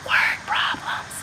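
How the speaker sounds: soft unvoiced whisper, like a secret. Shown as a demonstration. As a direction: whisper it as if it's not nice news. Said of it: "I'm whispering 'cause usually people whisper".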